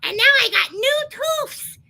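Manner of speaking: very high, squeaky mascot voice, British act dropped; high put-on cartoon character voice; Bucky. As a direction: talk like a silly little puppet confessing a joke. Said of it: "That's how I talk".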